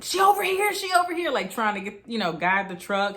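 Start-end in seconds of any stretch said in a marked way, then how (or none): 0.0-0.7 high, loud call across the street; yelling. Retold or imitated. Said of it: "He was yelling at people". 0.7-1.3 high, loud call repeated; yelling. Retold or imitated. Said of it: "He was yelling at people".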